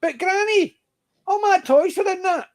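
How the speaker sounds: put-on high, wailing little kid; child's voice. Comic-strip kid protesting to his granny, high and whiny. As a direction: squeak it out like a dismayed child. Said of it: "the joke in the Bruins was".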